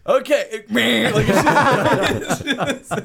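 laughing. Retold or imitated. Imitating Shannon laughing mid-line.

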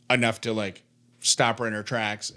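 usual speaking voice

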